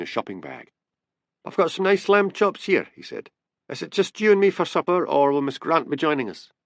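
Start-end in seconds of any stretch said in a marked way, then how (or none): 1.4-2.9 higher-pitched, lightly acted man; lightly voiced Dick. A narrator's reading. Housemate back with the shopping, lightly voiced and high. 3.7-6.5 higher-pitched, lightly acted man; lightly voiced Dick. A narrator's reading. Housemate back with the shopping, lightly voiced and high.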